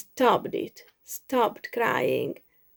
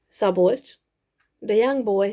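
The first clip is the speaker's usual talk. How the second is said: ordinary delivery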